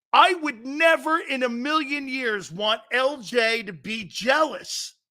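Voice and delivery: high, slow, loud pleading; mock panic. Real, not acted. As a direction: beg him in mock alarm not to upset her. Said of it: "Upset and jealous".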